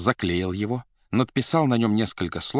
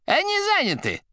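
very high, rattling voice on the line; rattling telephone voice; 3 dB louder than usual. A narrator's reading. The receiver answering the administrator, high and rattling. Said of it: "ответила трубка дребезжащим голосом".

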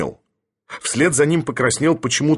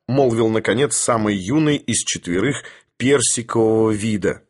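usual speaking voice

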